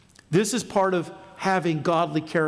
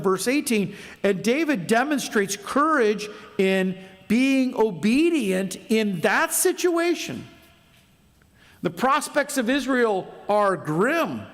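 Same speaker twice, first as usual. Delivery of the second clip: raised, higher preaching voice building in force; 5 semitones higher than usual. Acted. Pastor urging young people to be reliable, raised preaching voice. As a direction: drive it home from the pulpit. Preaches submission, David's courage.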